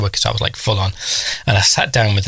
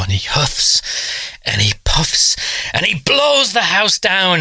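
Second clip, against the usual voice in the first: slow, higher storyteller voice on the wolf's puff; storybook wolf; 7 semitones higher, slower than usual. Retold or imitated. Teacher reading Three Little Pigs to the class, slow and dramatic. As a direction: draw it out like reading to little kids. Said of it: "the teacher got to the part".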